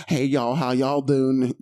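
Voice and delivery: slow, mocking Southern drawl on "y'all"; mock Southern accent. Friend mocks fake country talk with a slow Southern drawl. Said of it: "than fucking".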